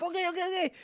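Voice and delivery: put-on high hurried childish okays; little daughter's voice. Dad quoting his daughter giving in, in a high child voice. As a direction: hurry like a scared toddler. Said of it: "she's like".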